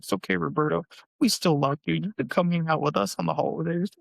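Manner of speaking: high-pitched, sing-song fake reassurance; mock consoling taunt. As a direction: tease a little brother with pretend comfort. Fake comforting taunt to brother.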